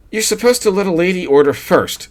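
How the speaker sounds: high, breathy-free gushing lady voice; wife cooing to her husband. A narrator's reading. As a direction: coo it like a tipsy wife. Gladys voiced in story.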